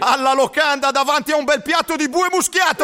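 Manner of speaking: high excited chant; excited shouting. Acted. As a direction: cheer it like hungry friends. (grida entusiaste)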